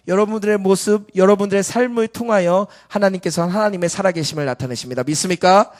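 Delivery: loud, insistent sermon delivery; forceful preaching. Real, not acted. Preacher asking congregation whether they believe, forceful preaching. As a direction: press the congregation for a response.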